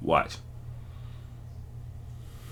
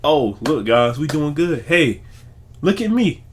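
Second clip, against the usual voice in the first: mocking a showboating coach, climbing higher; fake cheerful coach; 5 semitones higher than usual. Mocking how Coach Prime will not act, fake and show-off. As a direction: ham up a fake, attention-seeking coach. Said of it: "he ain't gonna fake it and be like".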